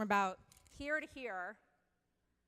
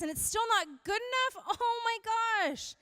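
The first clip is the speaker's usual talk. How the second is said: exasperated; 11 semitones higher than usual. Real, not acted. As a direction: throw up your hands at it all. Exasperated at impossible beauty standards.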